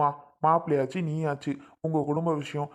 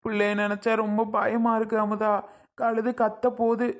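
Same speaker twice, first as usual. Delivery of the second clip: tearful worry; 3 semitones higher than usual. Acted. Father afraid for his child before an injection, tearful. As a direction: fret tearfully, close to weeping. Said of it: "எதுக்கு இப்ப அழுதுட்டு நிக்கிறியா".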